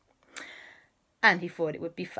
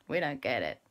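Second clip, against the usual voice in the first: ordinary delivery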